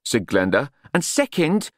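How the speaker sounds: telling Nutt off firmly; scolding Glenda. A narrator's reading.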